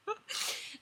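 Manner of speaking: unvoiced burst of laughter; breathy laugh. Real, not acted. Reader laughs at own text.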